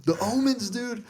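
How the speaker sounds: high, loud excited yelp. Retold or imitated. Retelling how his buddy freaked out over the book, excited yelp. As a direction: shout it like a friend freaking out.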